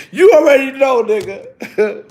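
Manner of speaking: laughing while saying it. Real, not acted. Repeating his answer to his son, laughing. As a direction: chuckle through the line.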